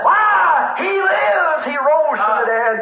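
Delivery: high shouted opening, settling by the end; exultant preaching shout. Real, not acted. Preacher proclaiming the resurrection to the congregation, exultant shout. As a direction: cry it out high, then come down.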